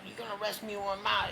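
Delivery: high, incredulous protest; indignant retort. Retold or imitated. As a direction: act out the outraged question to the cops. Retorting to cops arresting him.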